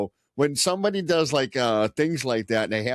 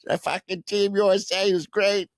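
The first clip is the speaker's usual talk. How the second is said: mock Boston fan; 9 semitones higher than usual. Joins the Boston impression.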